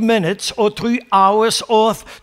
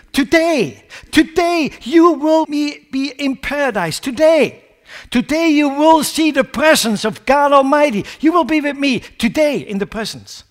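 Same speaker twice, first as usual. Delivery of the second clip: Today repeated, each one pressed hard and higher; emphatic Today; 6 semitones higher than usual. Retold or imitated. Preacher quoting Jesus to the thief, emphatic. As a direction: hammer home the word Today. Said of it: "What did Jesus say?".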